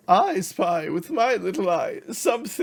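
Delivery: high, loud sing-out taunt; taunting text message. Acted. Rival taunts the van crew by text, gloating loudly. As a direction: crow it out mockingly. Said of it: "whoever reads it can hear it".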